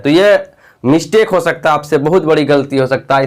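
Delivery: ordinary talk, nothing marked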